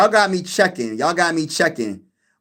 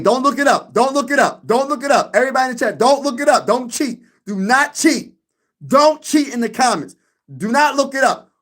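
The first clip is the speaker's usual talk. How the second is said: playful warning; 6 semitones higher than usual. Real, not acted.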